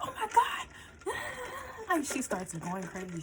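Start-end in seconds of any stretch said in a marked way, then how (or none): 0.1-0.7 much higher, loud mock shock; shocked gasp. Girlfriend seeing the ultrasound, shocked squeal. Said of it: "And she's like". 0.7-1.8 high laugh. Real, not acted. Laughing at her reaction.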